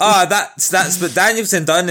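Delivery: hammed-up smug boast; mock Punk boast. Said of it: "and be like".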